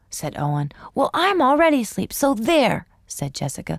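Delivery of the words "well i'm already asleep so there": put-on cheeky little girl, fast and high; teasing child. A narrator's reading. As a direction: tease your brother. Sister teasing brother.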